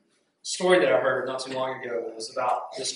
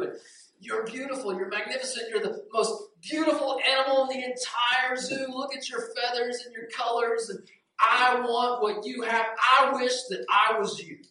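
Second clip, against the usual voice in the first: high, envious praise; gushing admiration; 7 semitones higher than usual. Acted. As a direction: gush longingly in a raised voice. Crow gushes at the peacock.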